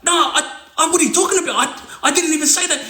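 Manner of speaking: nervous denial. Retold or imitated. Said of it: "starts going".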